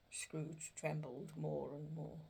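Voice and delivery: ordinary delivery, nothing marked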